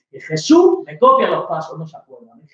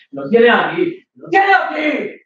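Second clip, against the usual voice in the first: second take high, slow, shouted; angry yell; 6 semitones higher, 3 dB louder, slower than usual. Acted. Boyfriend accusing girlfriend over her phone, angry yell. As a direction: roar the repeat.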